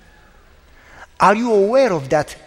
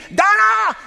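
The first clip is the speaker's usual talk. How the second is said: high, drawn-out cry of his wife's name; high-pitched alarmed yell; retold scream; 13 semitones higher, 3 dB louder than usual. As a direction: scream a warning across the street, re-enacted. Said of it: "I screamed".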